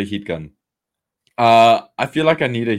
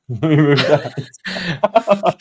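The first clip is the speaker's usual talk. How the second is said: laughing; 6 semitones higher, 3 dB louder, much slower than usual. Real, not acted. Laughing at his own joke.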